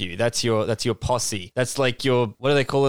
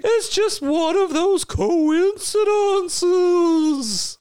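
very high, slow, spooky ghost voice; a drawn-out spooky wail of a ghost; slower than usual. Acted. A ghost introducing himself after slapping the player, high and spooky. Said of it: "All right, Slappy".

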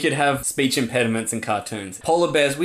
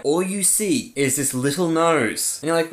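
ordinary talk

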